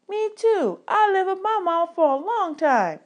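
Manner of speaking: put-on a young orphan eagerly backing his friend up, pitched high; small boy chiming in. A narrator's reading.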